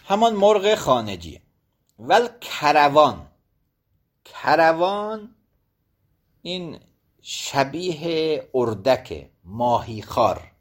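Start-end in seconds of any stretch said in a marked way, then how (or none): 4.2-5.3 کروان drawn out slowly as karavan, each syllable open; slow dictation of کروان for students; careful slow pronunciation. Shown as a demonstration. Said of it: "به فتح حروف الاول".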